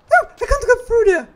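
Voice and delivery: high, loud protest; frustrated yell. Real, not acted. Player finding the path blocked, frustrated high yell.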